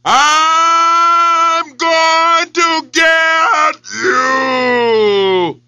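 very drawn-out echoing yell. Acted. Echo yells back at the lion. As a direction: stretch it like a well echo. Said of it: "it yelled directly back".

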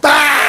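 high, loud mock shriek; imitated mom scream. A narrator's reading. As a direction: let out a long comic scream.

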